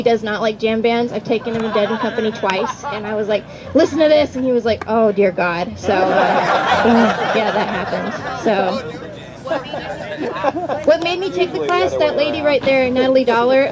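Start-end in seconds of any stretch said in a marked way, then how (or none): none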